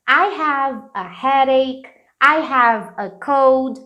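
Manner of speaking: slow, clear English examples; slow model sentences. Shown as a demonstration. Teacher modelling examples for the class. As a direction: read each example slowly and clearly. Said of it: "Então".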